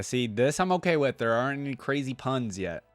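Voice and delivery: ordinary talk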